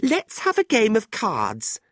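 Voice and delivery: high, bright burst; sudden suggestion. A narrator's reading. Tilda suddenly proposing cards to the group, eager. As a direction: pipe up with pitch leaping. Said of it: "she said suddenly".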